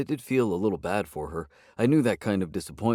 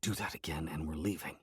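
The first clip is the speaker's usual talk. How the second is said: hushed warning, much softer; lowered voice; 3 semitones lower, 11 dB quieter than usual. A narrator's reading. Narrator warns his cat under his breath, hushed. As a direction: drop the voice to a private threat. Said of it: "lowering my voice".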